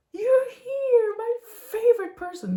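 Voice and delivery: very high, gushing puppy joy; excited dog voice. Pretend. Dog greeting her husband in the morning, high squealing joy. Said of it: "he's like".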